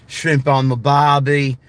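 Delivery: hammed-up Australian, R's dropped; Australian accent. Shown as a demonstration. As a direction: draw it out in a mock Australian accent. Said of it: "My Australian accent".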